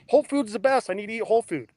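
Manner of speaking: mocking whole-food believers. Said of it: "you people that are eating whole food thinking".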